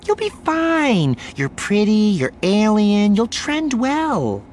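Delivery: very high, drawn-out showy encouragement; breezy reassurance. A narrator's reading. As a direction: reassure her with glib, punchy confidence. Reporter breezily reassuring her nervous guest.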